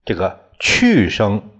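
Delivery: ordinary talk, nothing marked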